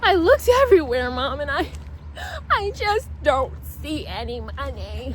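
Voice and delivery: high, loud mock wail of a kid fading into a whine; hammed-up whiny kid, pitch jumping high; dramatic crying kid. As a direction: overact a sobbing boy pleading with Mom. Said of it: "he's crying and he's so dramatic".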